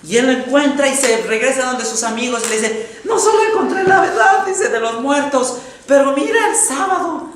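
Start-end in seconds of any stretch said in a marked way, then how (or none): 3.0-7.3 high, loud thrilled exclamation; excited announcing. Retold or imitated. Man tells his church friends, excitedly loud and high. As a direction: burst out with the discovery, voice jumping high.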